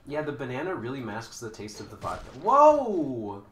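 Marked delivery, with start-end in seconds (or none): 2.4-3.4 surprised shout. Real, not acted. Surprised during fight.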